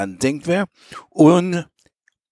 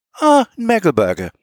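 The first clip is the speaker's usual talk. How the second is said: lightly Prussian-tinged Platt, higher pitched; light Prussian accent; 7 semitones higher than usual. A narrator's reading. Colonel echoing the prisoner's origin, light Prussian accent. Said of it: "bloß mit einem leichten preußischen Akzent".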